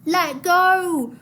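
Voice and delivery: sharp shouted order; high loud woman's yell. Acted. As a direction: yell down from the boat. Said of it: "a woman in the dinghy yelled down at him".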